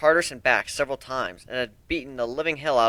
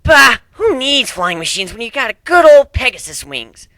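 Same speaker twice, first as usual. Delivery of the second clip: loud, much higher scoffing brag; bragging Rainbow Dash; 11 semitones higher, 12 dB louder than usual. Acted. Rainbow Dash scoffing at flying machines, loud brag. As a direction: boast like the fastest flier alive. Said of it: "Rainbow Dash bragged".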